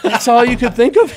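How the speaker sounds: giggling self-scolding question; laughing. Retold or imitated. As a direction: laugh at your own dumb line. Mocking himself, laughing.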